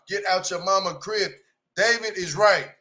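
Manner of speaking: ordinary talk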